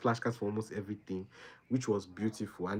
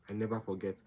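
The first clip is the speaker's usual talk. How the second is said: soft echo; 7 dB quieter than usual. Real, not acted. Student recalling her study method, quiet echo to herself. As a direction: repeat "I never forget" much softer, to herself. Repeats line, second softer.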